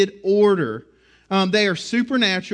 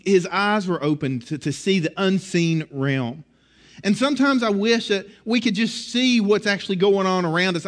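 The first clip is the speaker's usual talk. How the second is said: ordinary delivery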